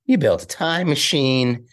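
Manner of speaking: drawn-out Marty McFly impression; Michael J. Fox. Comedian doing his act for the actor himself, Marty impression. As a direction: stretch the words like an incredulous Marty. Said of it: "do an impression of me".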